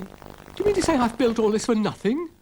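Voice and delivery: a pompous little dog protesting; Dougal the dog. Acted. Dougal insisting to Florence, high fussy voice.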